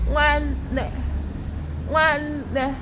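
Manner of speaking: syllables chopped apart like a robot. Shown as a demonstration. Teacher shows pupils the word, robot voice. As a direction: play a robot, word in pieces. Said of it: "Can you say it like a robot?".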